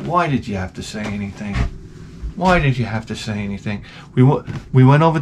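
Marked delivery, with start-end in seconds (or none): 0.0-1.6 soft, lower exasperated mutter. Retold or imitated. Narrator to the parent who warned the kids, soft exasperated grumble. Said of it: "I'm like". 2.4-3.8 soft, low repeated grumble; exasperated mutter. Retold or imitated. Narrator repeating himself to the parent, soft fed-up mutter. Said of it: "I'm like".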